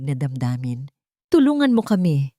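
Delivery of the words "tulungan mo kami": pleading. A narrator's reading. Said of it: "ay puno ng pakiusap".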